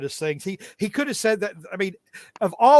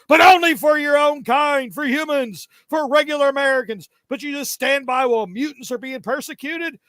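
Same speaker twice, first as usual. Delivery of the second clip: higher, heated mock rant; Wolverine; 8 semitones higher than usual. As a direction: rant indignantly, pitch climbing. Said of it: "goes into this, uh, idiotic rant".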